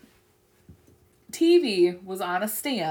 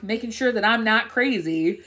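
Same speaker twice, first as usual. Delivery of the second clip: normal talking voice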